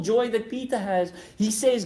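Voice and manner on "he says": ordinary talk, nothing marked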